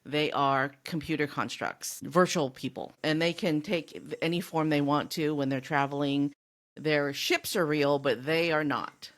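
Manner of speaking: usual speaking voice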